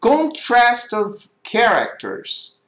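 very slow, careful "Contrast of characters"; slow reading aloud. Shown as a demonstration. Teacher reading the slide title to students, very slow. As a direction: spell out each word slowly for students. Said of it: "Ok, olha só".

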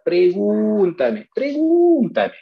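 stretched, rising then falling urging; drawn-out plea. Real, not acted. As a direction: draw the word out, swooping high.

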